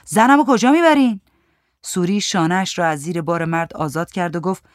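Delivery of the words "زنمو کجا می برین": raised, louder voice; angry shout. A narrator's reading. Panting man yells at woman taking his wife, shouting. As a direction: shout it out, higher. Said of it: "داد زد".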